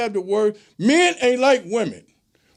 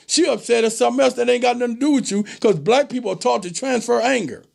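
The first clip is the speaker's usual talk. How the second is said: normal talking voice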